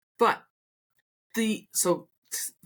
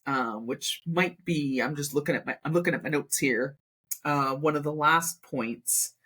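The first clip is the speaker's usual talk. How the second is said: ordinary talk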